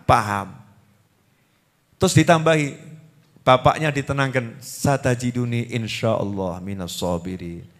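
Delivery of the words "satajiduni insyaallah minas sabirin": much softer, lower and slower chanted Arabic; Quranic recitation. Acted. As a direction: recite the verse gently and reverently. Quran verse quoted in sermon. (lantunan ayat lirih, pelan, dan khidmat)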